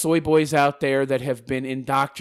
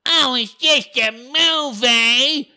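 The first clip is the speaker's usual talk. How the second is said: mocking whine; 9 semitones higher, 4 dB louder, much slower than usual. Mocking opponent's dismissive line.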